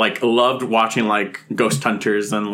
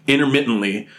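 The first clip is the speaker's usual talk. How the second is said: ordinary talk, nothing marked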